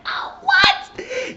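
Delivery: very high squealed disbelief; shocked shriek. Retold or imitated. Viewer floored by the false name, squealing shock. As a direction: shriek it at the big reveal.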